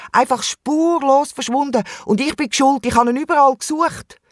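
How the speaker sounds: high-pitched guilty alarm; distressed confession. A narrator's reading. Wombat confesses the fruitless search to kangaroo, distressed and high. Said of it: "beichtet er im Känguru".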